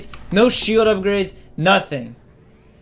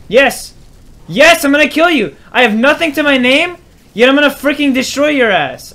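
excited yelling; 6 semitones higher, 6 dB louder than usual. Real, not acted. Turning the fight.

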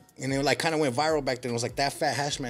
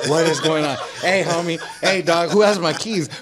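loud, mock-panicked calling out; hammed-up confused pleading to friends; retold stoned bewilderment; 4 semitones higher, 8 dB louder than usual. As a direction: play up being high and lost. Acts out stoned panic at event.

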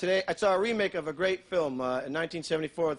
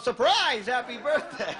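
excited surprise shout; 6 semitones higher, slower than usual. Retold or imitated. Girlfriend popping up at the morgue on his birthday, excited shout. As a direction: imitate her springing a surprise. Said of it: "she pops up, she goes".

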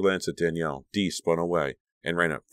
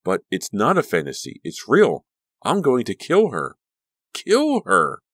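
building to a high, loud cry; murderous frenzy; 7 semitones higher, 6 dB louder than usual. A narrator's reading. Danielle attacking Dee, building to murderous frenzy. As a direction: climb steadily into bloodlust. Murderous thoughts at fight's climax.

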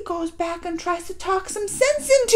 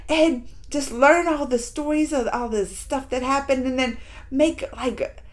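ordinary talk

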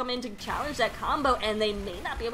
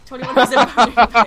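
much louder, fast, laughing; laughing loudly; 12 dB louder, faster than usual. Real, not acted. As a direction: blurt it out laughing. Laughing joke.

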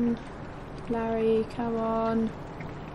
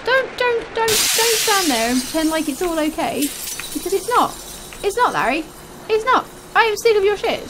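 put-on furious parent telling off a child; angry scolding; 6 semitones higher than usual. Scolding Larry the game character.

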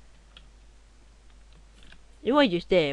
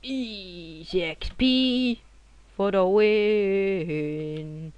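slow, gloating gamer catchphrase; drawn-out taunt; much slower than usual. Pretend. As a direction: stretch it out like a cocky catchphrase.

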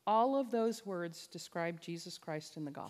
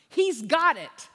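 loud reassurance; 7 semitones higher, 13 dB louder, slower than usual. Real, not acted. Loud first take.

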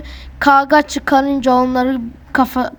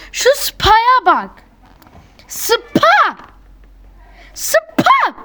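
rising higher and higher on "Spa"; excited squeal; 7 semitones higher, much slower than usual. Real, not acted. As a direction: squeal it out in delight. Repeated excited exclamations.

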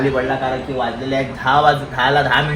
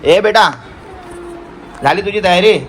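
raised voice calling across the house; 4 semitones higher, 3 dB louder than usual. Real, not acted. Father calling his son from another room, loud.

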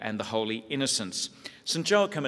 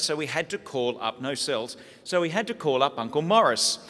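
ordinary delivery

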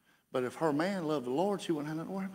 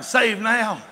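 loud, raised plea of a crowd; higher, louder imitated crowd cry; 6 semitones higher, 9 dB louder than usual. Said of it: "And they're crying".

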